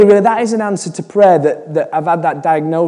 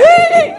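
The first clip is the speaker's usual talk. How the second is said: very high-pitched ticklish squeal; childlike giggle. Retold or imitated. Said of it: "I'm giggling like a child".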